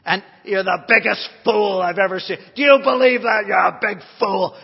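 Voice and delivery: very loud mock-Irish heckling; imitated heckler's shout. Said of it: "shouted me down on every point".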